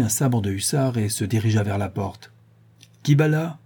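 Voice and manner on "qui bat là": mild call through the door. Acted. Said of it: "cria-t-il".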